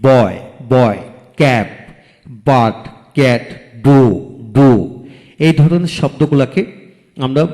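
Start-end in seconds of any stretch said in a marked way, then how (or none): 0.0-4.9 each word from বয় to ডু as one drawn-out beat; one-beat word drill. Shown as a demonstration. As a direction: say বয়, cap, ডু slowly, a pause after each. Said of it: "একসাথে উচ্চারণ করা যায়".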